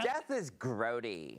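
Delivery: put-on valley girl. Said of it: "only talk like Valley Girl".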